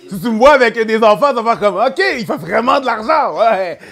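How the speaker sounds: high and loud with bursts of laughter; loud, laughing. Real, not acted. Comedian joking about his own future kids, laughing loudly. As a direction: crack up while riffing. Cracking up while extending the joke.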